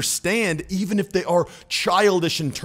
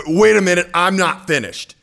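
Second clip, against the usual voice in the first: sudden firm tone change; 5 dB louder than usual. Retold or imitated. Imitating Trump cutting off the reporter, louder and commanding. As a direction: snap the tone up to take the floor. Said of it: "His whole tone changed".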